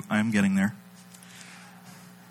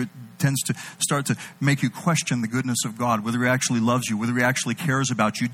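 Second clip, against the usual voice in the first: ordinary talk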